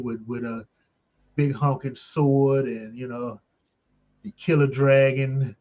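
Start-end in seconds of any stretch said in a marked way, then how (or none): none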